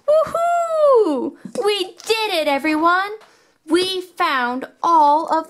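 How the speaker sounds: very high shouted woohoo; excited cheer. Real, not acted. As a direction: cheer at the top of your voice. Cheering, found all pups.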